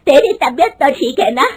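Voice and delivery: put-on indignant shouting old woman; angry scolding. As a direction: rage at her for wanting a job. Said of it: "उसकी सास गुस्से में कहती है".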